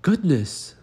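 high startled gasp of a word; surprised frogs. Acted. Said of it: "said the frogs".

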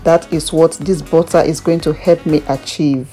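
ordinary talk, nothing marked

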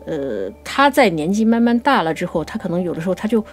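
ordinary delivery

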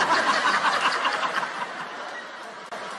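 helpless laugh after the punchline; laughing at own joke. Real, not acted. Friend telling jokes, cracks up at his punchline.

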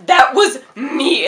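loud, slow triumphant announcement; proudly unmask yourself; shouted confession; retold reveal. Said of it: "And I was like".

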